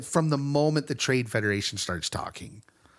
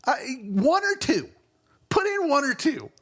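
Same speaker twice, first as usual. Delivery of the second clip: much higher, pleading in exasperation; exasperated; 13 semitones higher than usual. Real, not acted. Host begging Lucas to cut the catchphrases, exasperated. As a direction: beg for restraint, pitched way up.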